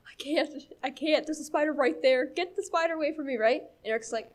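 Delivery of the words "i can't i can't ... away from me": higher-pitched, lightly frantic protest; mock panicked pleading. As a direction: act out a frightened kid begging for help. Acts out panic at the spider.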